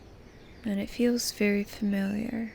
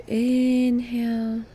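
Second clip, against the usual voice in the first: "inhale" stretched slowly, swelling on its first syllable; drawn-out breathing cue; 3 semitones higher, 6 dB louder, slower than usual. Shown as a demonstration. Guide pacing the listener's inhale, drawn-out breathing cue.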